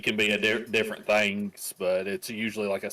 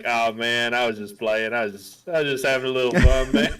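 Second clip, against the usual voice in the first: louder, slightly quick laid-back excuse; sheepish wrestler; 2 semitones higher, 7 dB louder than usual. Retold or imitated. [name] imagining R-Truth excusing himself, loud and sheepish. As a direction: brush it off like a kid caught. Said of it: "coming out like".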